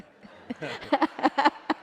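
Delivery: high bursting laugh at a stuck gift; a loud, high giggle of embarrassment; embarrassed laugh. Real, not acted. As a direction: laugh out, flustered and amused.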